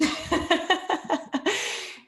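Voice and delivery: breathy high laugh; a quick amused laugh; light laugh. Real, not acted. Laughs about boring degree.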